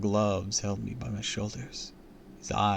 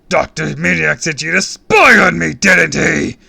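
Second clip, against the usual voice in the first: loud, high accusing bellow; booming; 8 semitones higher, 17 dB louder than usual. A narrator's reading. Villain accusing kid of spying, booming. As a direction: boom the accusation in fury. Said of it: "he boomed".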